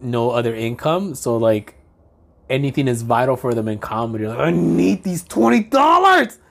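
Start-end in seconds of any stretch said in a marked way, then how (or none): 4.4-6.4 high, slow, hammed-up pleading; mock a broke comic begging in a put-on voice; exaggerated needy wail; desperate comedian. Mocking a desperate struggling comedian.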